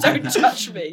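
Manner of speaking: mock tough guy, half laughing; badass sailor. Player imitates a badass sailor guarding new clothes, loud and laughing. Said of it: "There's a badass sailor, like".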